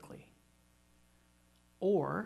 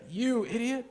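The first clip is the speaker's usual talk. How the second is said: mock insult; 5 semitones higher, 3 dB louder than usual. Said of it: "So he says".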